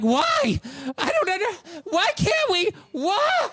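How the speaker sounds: very high wailing kid imitation; hammed-up wail of a child too upset to finish; mock crying child. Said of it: "and he is crying".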